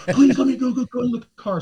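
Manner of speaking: high-pitched imitated panic; frantic woman. Woman frantic at cab window.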